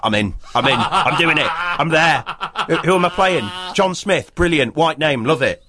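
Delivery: giddy hammed-up yes-man; mock eager Mel. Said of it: "He's like".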